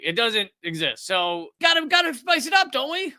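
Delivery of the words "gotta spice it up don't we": high-pitched teasing singsong question; mock eagerness. Commentator needling a news network for padding, mock eagerness. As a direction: pitch it way up, playfully mocking. Mocking the network's need for drama.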